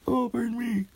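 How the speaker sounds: squeaky high door; a tiny door calling up; high little door voice. A narrator's reading. Small door calling the girl, high.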